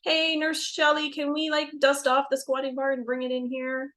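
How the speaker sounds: high, playful call to the nurse; joking request. Retold or imitated. Doula teasing the nurse at a birth, joking request. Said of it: "I jokingly say to the nurse".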